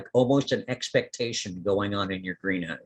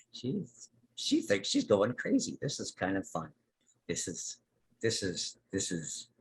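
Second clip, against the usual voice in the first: giggle, then murmur it quietly; giggling plant voice; 2 semitones lower, 8 dB quieter than usual. Acted. The little plant delighting in confusing Lara, giggly and soft. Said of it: "that little voice that you heard originally goes".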